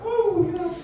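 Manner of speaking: very high mock woman's voice; bad girlfriend impression. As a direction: badly fake a girlfriend way up high. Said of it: "That's a really bad impression".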